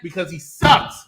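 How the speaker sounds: ordinary delivery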